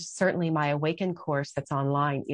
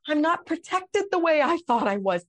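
pleading with God; 11 semitones higher, 3 dB louder than usual. Retold or imitated. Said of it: "And I'm like".

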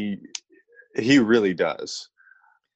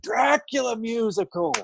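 a higher, slow eager announcement; mock excited Rachel; 12 semitones higher, slower than usual. Rachel volunteering him at the bar, slow and higher. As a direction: pitch it up and draw it out, mock eager. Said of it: "And she's".

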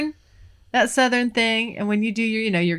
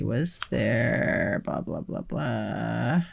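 slow mumble; 11 semitones lower, 7 dB quieter, much slower than usual. Real, not acted. Skimming questions aloud.

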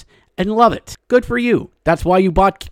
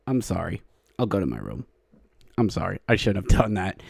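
much softer and lower, slinking off; sheepish mock apology; 7 semitones lower, 9 dB quieter, faster than usual. After his bad pun, a sheepish hushed apology. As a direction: apologize like a scolded kid going to his room.

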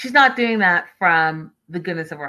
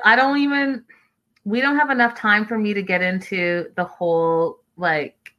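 ordinary talk, nothing marked

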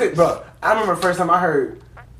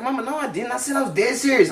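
pleading denial; 7 semitones higher than usual. Retold or imitated. Little brother denying to mama he cussed, imitated pleading. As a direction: plead it up high and small.

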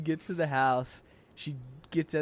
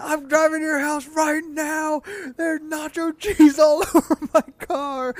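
much higher and louder, slow, with a laugh; mock furious yelling; 13 semitones higher, 11 dB louder, slower than usual. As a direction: ham up the raging youth leader. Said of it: "She's like".

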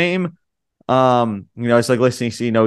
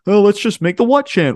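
higher, louder, mocking the fans; 9 semitones higher, 4 dB louder than usual. Host mocks bored crowd with a higher, louder, mocking voice. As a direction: sneer at fans who start chants.

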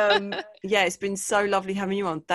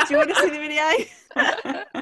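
slow, drawn-out, smug; slowly, proudly offering the video; mock proud boyfriend; 8 semitones higher, 4 dB louder, slower than usual. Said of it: "the first thing he said".

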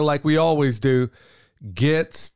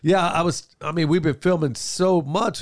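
ordinary talk

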